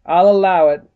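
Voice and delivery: stern judge with a hint of southern drawl; slight southern drawl. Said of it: "slightly southern drawl".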